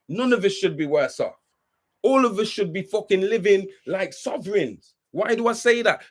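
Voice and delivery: ordinary talk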